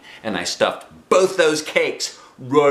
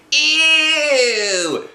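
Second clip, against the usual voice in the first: high squealing ew of disgust; loud high little-girl ew; 6 dB louder than usual. Acted. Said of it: "she said".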